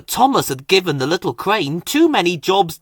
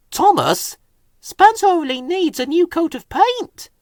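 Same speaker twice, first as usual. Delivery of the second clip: high, anxious crane voice; fretful little crane; worried crane voice; 14 semitones higher than usual. A narrator's reading. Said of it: "he said".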